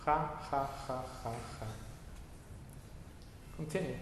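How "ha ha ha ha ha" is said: soft ha ha. Shown as a demonstration.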